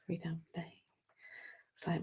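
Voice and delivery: whispered aside. Real, not acted. Host muttering to listeners, whispered aside. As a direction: drop to a breathy whisper.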